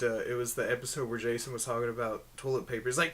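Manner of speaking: ordinary delivery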